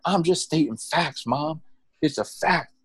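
high-pitched, insistent mock defense; playful, emphatic back-talk; acted protest. Acted protest to scolding mother.

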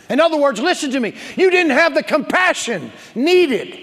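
high, loud pulpit indictment; full-force preaching. Acted. Pastor voicing Jesus's rebuke to the uncaring, loud preaching. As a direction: hammer each charge home loudly.

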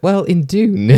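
high, laughing Jake voice; squeaky laughing take on Jake; Jake impression. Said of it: "And Jake's like".

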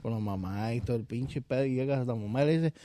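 ordinary delivery

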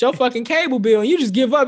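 loud, exasperated complaining; exasperated rant. Real, not acted. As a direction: vent it loudly, fed up. Ranting about call centers.

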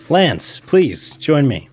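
loud summons across the club; calling out. Acted. Boss calling his guest across the club, loud summons. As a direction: call him over, loud and commanding.

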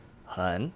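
很 soft, slow and low; third tone. Shown as a demonstration. Teacher giving words by themselves, 很 in a low third tone. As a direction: sink 很 into a slow, dipping third tone. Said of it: "in the third tone means very".

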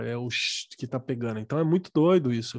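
ordinary talk

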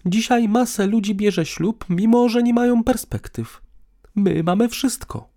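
high-pitched woman making her case; teacher's tone. A narrator's reading. Woman argues for marriage to partner, lightly teacherly. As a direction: lecture him in a high voice. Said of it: "powiedziała tonem nauczyciela".

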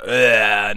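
put-on loud effortful grunt like an old dad. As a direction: grunt as if bending down hurts. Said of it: "like those old dad noises".

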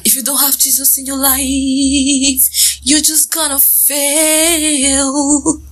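high, slow preacher voice; preachy sermon voice. Pretend. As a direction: preach it slowly. Preachy Jesus line.

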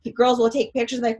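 usual speaking voice

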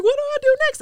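very high, loud urgent question; mock panicked plea. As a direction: blurt it in a high acted panic. Panicked question when voice goes quiet.

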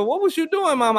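high mock voice asking mama; mock questioner. Voicing a friend quizzing mom, high. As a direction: pitch it up high for the asker.

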